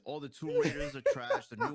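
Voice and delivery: blurted out high and loud through a laugh. Real, not acted. Co-host laughing while trashing Tomb Raider movies. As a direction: laugh it out as you trash them. Laughing rant about bad adaptations.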